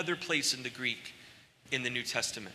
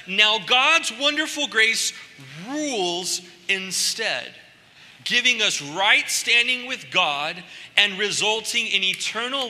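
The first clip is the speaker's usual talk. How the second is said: high, loud and slow, every word lifted; scripture reading, preaching; 5 semitones higher, 5 dB louder than usual. Acted. Pastor reading Romans aloud to the church, proclaiming. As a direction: proclaim the verse like good news to the hall.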